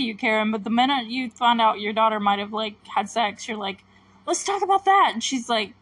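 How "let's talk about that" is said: high, louder imitation of a mother; playacted insistent parent; mock motherly demand. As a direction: pitch it up, mocking her sudden concern. Mimicking mother in retold argument.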